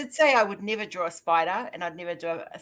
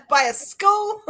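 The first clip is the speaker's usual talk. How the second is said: high, louder incredulous question with laughter around it; retold disbelief; 13 semitones higher, 6 dB louder, slower than usual. Caller retells finding snakes by a school, amused disbelief.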